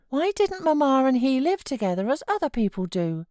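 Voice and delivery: put-on a fretful child pleading to stay; sickly child. A narrator's reading. Boy refusing to leave his uncle, high fretful whine.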